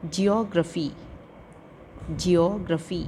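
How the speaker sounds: "ज्योग्राफी" as a measured model word; careful pronunciation. Shown as a demonstration. Teacher giving pupils words to practise, careful pronunciation. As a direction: say "ज्योग्राफी" distinctly for repeating. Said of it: "listen to the pronunciation of the".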